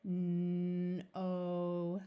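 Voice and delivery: N and o held long; sounded out slowly. Shown as a demonstration. Teacher blending sounds for young readers, stretched. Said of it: "we sound it out slowly".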